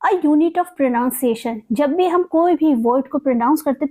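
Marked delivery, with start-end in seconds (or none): none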